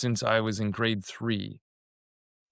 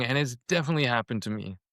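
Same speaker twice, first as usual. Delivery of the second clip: ordinary talk, nothing marked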